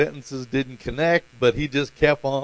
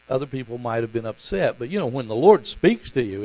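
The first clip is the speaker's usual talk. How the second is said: normal talking voice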